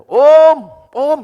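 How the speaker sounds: loud, high call from outside the house; mock call of Om, Om. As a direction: shout the name as if from the street. Men calling him from outside.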